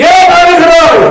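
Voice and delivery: loud insistent voice of God; booming question. Acted. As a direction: call it out from the pulpit. God voiced asking why.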